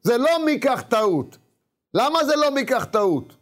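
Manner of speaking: high, drawn-out sermon delivery; emphatic preaching. Real, not acted. Preacher posing a puzzle to listeners about Jacob, emphatic and high. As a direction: preach it high, stretching the key words. Preaches the question at full force.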